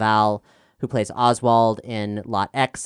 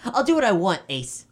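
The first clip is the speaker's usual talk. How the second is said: defiant; 8 semitones higher than usual. Acted. Defiant kid brother.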